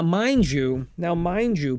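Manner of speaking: usual speaking voice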